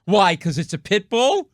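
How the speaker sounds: indignant dog owner, snippy; defensive retort. Retold or imitated. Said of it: "he goes like this".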